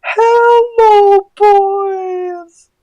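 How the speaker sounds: very high, drawn-out falsetto; badly faked girl voice, loud and squeaky; Lenny Loud. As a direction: stretch each word in a mock high-pitched voice.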